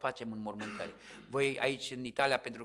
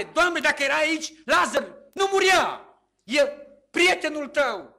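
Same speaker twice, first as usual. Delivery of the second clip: high, loud lament; grieving sister's plea; 13 semitones higher, 13 dB louder than usual. Retold or imitated. Martha to Jesus at the tomb, a loud grieving plea. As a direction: cry out your reproach to the Lord.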